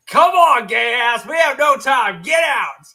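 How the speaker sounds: high, loud, impatient teacher shout; angry shouting. Retold or imitated. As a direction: bark it out at the kid. Teacher yelling again.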